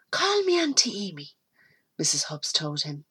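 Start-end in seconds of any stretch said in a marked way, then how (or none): 0.1-1.4 gentle. Acted. Amy speaks gently to the boy.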